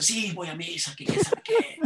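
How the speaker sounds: mock her reply, climbing into falsetto; hammed-up squeaky retort; mock indignant churchgoer. Mimics the complaining lady's reply.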